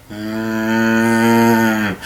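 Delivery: low drawn-out "mmm" in reply; long monotone undead groan; zombie groan. Retold or imitated. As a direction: answer with a droning zombie moan. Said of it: "He replied".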